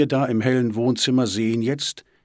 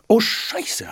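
high startled cry of dismay; exclaimed curse; 12 semitones higher, 4 dB louder than usual. A narrator's reading. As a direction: blurt it out on suddenly remembering. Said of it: "hat sie gerufen". (erschrockener Ausruf)

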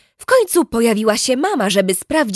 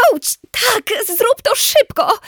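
high, slow pained plea; high tense plea; pained urgency; 14 semitones higher, slower than usual. Acted. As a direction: grit it out through the pain. Waiting for bite to be pulled off.

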